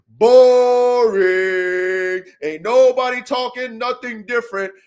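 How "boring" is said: loud, long drawn-out jeer; mocking heckle. Real, not acted. Host trashing today's dog content, drawn-out mocking heckle. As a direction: stretch BORING out on a held note.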